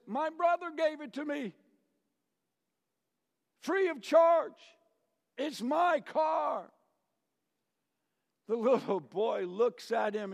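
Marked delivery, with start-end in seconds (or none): 0.1-6.7 much higher and louder, slowing on charge; proud boast. Acted. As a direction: show off the gift with pride. College boy proudly boasting.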